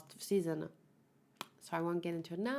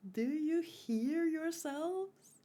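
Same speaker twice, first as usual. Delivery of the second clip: high, slow, disbelieving; incredulous; 9 semitones higher, much slower than usual. Real, not acted. Vlogger scolding viewers who side with Zeke, high and slow. Exasperated retort to mocked viewers.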